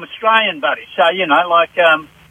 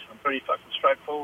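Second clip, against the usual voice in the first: softer, muttered swear; a quiet slip of the tongue; slipped swear; 4 semitones lower, 10 dB quieter, faster than usual. Real, not acted. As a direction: let the swear slip out under your breath. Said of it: "whoa, whoa, You just let something slip".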